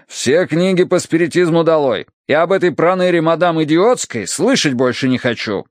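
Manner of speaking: irate order. Acted. Colonel orders butler to remove books, irate. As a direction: order it out in indignation.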